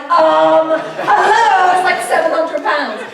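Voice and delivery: loud, high, indignant retelling; hammed-up loud indignation; mock outrage. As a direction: cry out as if scandalised. Re-enacts mum's outrage at losses.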